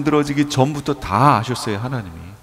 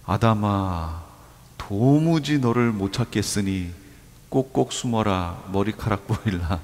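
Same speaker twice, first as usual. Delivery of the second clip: low, soft, slow playful hide-and-seek chant; mock hide-and-seek God; 5 semitones lower, 6 dB quieter, slower than usual. Pastor jokes God plays hide-and-seek with Adam, soft and playful. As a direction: tease it like a game of hide-and-seek. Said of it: "그거 하신 거예요, 지금? 아니에요".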